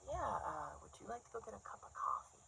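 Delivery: soft, shy invitation, rushed at the end; man from group. Acted. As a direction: ask timidly in a hushed guy voice. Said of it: "it's one of the guys".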